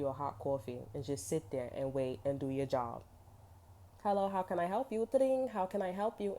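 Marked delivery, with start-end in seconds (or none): 4.0-6.3 higher-pitched receptionist voice; customer-service greeting. Acted. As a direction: answer like a chirpy front desk. Acts out answering a service call.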